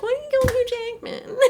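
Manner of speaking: high, fast laughing plea; high giggling complaint to the film; laughing protest. Retold or imitated. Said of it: "you're also like".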